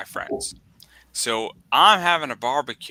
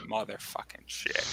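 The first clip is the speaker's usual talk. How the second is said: near-whispered swearing to himself; hushed curse; 3 semitones lower, 14 dB quieter than usual. Retold or imitated. Son reacting to mom's tequila ban, hushed curse. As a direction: curse very softly under the breath.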